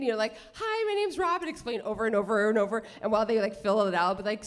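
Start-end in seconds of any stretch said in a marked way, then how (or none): 0.5-1.5 high-pitched mock greeting; perky introduction. Mimics repeated cheery introductions to passengers.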